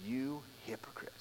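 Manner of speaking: dramatic whisper after a pause; whispered "You hypocrite" after a dramatic pause; whispered rebuke. Retold or imitated. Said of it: "dramatic pause".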